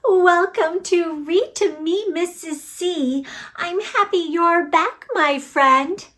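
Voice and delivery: plain reading voice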